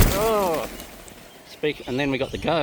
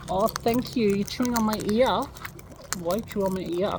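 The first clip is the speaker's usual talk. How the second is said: pet talk; 8 semitones higher than usual. Real, not acted. Bird chewing ear, pet voice.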